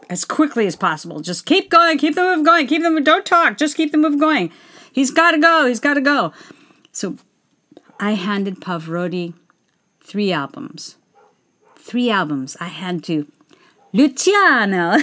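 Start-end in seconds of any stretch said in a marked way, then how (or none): 1.2-6.3 pushy handler. Retold or imitated. Event handler hurrying fans past the singer, impatient bark. 13.9-15.0 put-on Italian accent. Fan greeting [name], slight Italian accent. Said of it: "you speak in an Italian accent".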